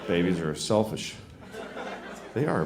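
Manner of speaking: ordinary talk, nothing marked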